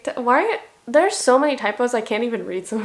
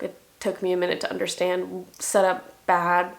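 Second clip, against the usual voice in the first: normal talking voice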